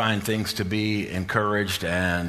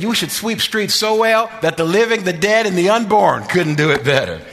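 building preaching climax; 10 semitones higher, 6 dB louder than usual. Retold or imitated. Pastor driving King's words home to congregation, rising preaching climax. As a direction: rise much higher and louder to a ringing peak on "sweep streets so well".

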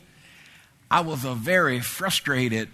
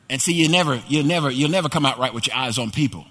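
ordinary delivery

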